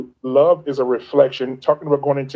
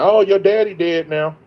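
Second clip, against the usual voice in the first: mocking taunt; 4 semitones higher than usual. Mocking message from a girl.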